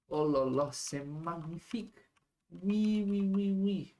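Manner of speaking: put-on high, loud hammy French accent; french chef. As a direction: gush like a proud French chef. Gushing over drawing in French.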